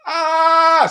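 long loud high ahh; a held scream of pain. Retold or imitated. Describing jumping into the sea with his wounds, a long high scream.

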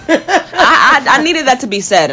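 laughing through the words; laughing at her own rant. Real, not acted. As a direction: giggle it out, pleased with herself. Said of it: "You're gonna be so loud".

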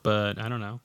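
speaking turned away from the microphone; voice cutting out. Real, not acted. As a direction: voice dropping away from the mic. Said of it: "do you hear how your voice is cutting out".

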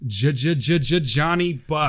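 stuttered DJ-style name drop; hype-man stutter. Acted. Signing off Johnny Bucks's letter, hype-man stutter. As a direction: repeat the first sound like a hype announcer.

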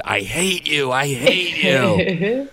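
high, drawn-out bratty wail; mock childish scream. Little girl screaming at her mother, mock bratty wail. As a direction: whine it like a furious little girl. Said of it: "as my mom screamed".

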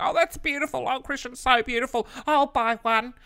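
high mock gushing voice; gushing fan mock. Mocking fans buying the notepad, high gushing voice. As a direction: gush like an adoring fan.

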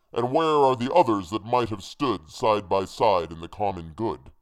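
loud, forceful hangman's voice; accusing hangman. A narrator's reading. Hangman rebuking the one who served him, loud accusing declamation. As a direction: declaim it as the hangman, hitting words hard.

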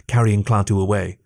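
ordinary delivery, nothing marked

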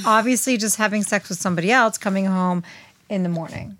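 ordinary talk, nothing marked

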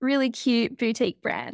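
normal talking voice

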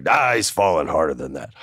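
low, dismissive put-on voice; Vince. Boss waving away worry about injury, dismissive. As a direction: drop into a boss impression, brushing it off. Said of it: "And Vince is like".

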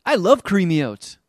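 high, loud eager nerd voice; excited nerd. Retold or imitated. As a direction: gush like a skinny nerd. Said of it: "He's like".

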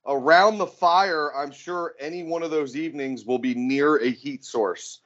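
high, drawn-out event plug; high-pitched promo read, easing down at the end; mock announcer promo. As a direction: stretch the names like an announcer.

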